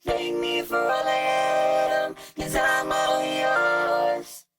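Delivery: normal talking voice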